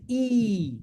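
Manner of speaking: "e" held long as a steady ee; long ee vowel. Shown as a demonstration. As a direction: stretch "e" out for two counts. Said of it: "We pronounce ya as e".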